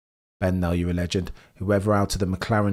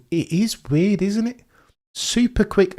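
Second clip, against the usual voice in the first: amazed; 11 semitones higher, 3 dB louder than usual. Real, not acted. Amazed at lap.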